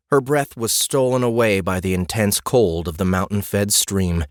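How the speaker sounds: plain reading voice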